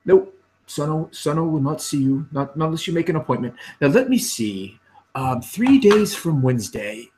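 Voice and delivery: lightly voiced officious clerk. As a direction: brush them off like a fussy clerk. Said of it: "he comes back out and he says".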